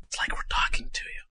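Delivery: soft whisper. Acted. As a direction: whisper it conspiratorially. Whispered sign-off.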